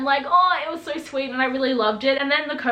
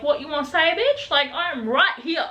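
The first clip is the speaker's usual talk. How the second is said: sassy taunt; 4 semitones higher than usual. Retold or imitated. Clary and Jace confronting the liar.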